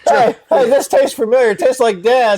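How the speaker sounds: high, excited acted girl; mock girl voice. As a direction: squeal it like a shocked girl. Voicing girl in the story.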